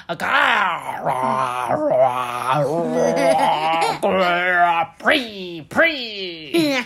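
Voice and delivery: loud throaty bear gargle, spitting; while gargling. Shown as a demonstration. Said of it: "熊医生张大他的嘴巴说". (熊式漱口声)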